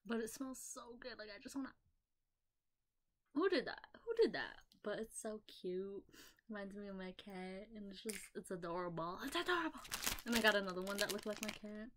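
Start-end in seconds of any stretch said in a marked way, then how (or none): 3.3-4.6 high cutesy question that swoops down; mock-surprised baby talk; playful baby talk. Playful baby talk about the product. 9.2-9.8 high, squeaky adoring voice; playful baby-voice gushing; cutesy gushing. Pretend. Repeats it in a cutesy voice.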